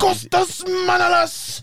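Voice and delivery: high drawn-out shout of the name; hyping up a player's name in a drawn-out shout; excited shout. Real, not acted. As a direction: belt the name out like a chant.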